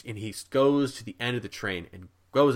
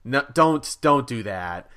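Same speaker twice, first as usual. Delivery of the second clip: pleading crowd; 3 semitones higher, 7 dB louder, much slower than usual. Retold or imitated. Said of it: "all the people on the train immediately go".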